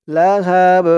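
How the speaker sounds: "লাহাব" with a bouncing final b; qalqala. Shown as a demonstration. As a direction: recite "লাহাব", pushing out the b with a small echo. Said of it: "একটু ধাক্কা দিয়ে পড়তে হবে". ("লাহাব"-এর বা-তে ধাক্কা দিয়ে কলকলা)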